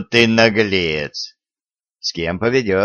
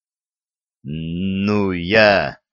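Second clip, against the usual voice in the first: ordinary delivery, nothing marked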